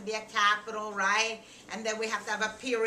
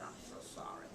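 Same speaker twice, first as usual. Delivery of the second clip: whispered apology; 18 dB quieter, faster than usual. A narrator's reading. Rachel apologizes once tears fall, barely audible whisper. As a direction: whisper it as a small, sad echo. Repeats the tearful apology softly.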